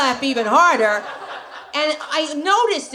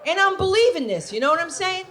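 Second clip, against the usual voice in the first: ordinary talk, nothing marked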